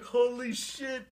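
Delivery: high, loud and slow, drawn out in disbelief; a gasped holy shit at a big surprise; stunned excitement. Retold or imitated. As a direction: let the shock stretch the words out. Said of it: "I was like".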